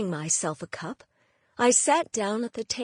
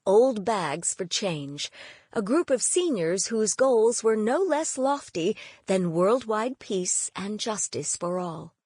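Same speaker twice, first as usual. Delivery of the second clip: ordinary delivery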